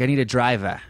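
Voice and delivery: Boston accent, "driver" ending in an open "ah". Shown as a demonstration. Comparing two towns' speech, Boston accent on "driver". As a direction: drop the R in "driver" to "ah", Boston style. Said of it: "Boston accent is more like".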